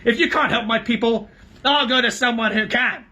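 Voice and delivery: high, loud threatening scream; dwarf threatens the king. A narrator's reading. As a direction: rise into a scream. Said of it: "whose voice now rose to a scream".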